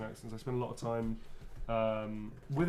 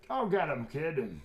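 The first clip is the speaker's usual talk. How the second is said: rousing catchphrase; 4 semitones higher, 5 dB louder, slower than usual. Acted. Saying a character's motivational quote.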